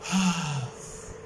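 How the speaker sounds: long, soft breathy yawn sigh out; open-mouthed yawn exhale. Shown as a demonstration. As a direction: let the air go like a tired yawn. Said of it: "you normally do something like this".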